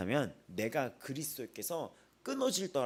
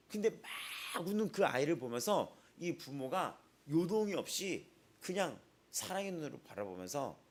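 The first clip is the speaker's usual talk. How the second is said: usual speaking voice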